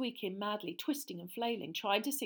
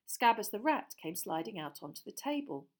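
plain reading voice

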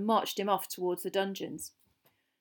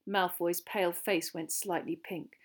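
plain reading voice

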